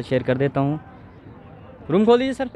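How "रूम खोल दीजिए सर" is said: loud, high call across the lot. Real, not acted. Vlogger calling to the owner to open the engine room, loud high call. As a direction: raise the voice to reach the owner.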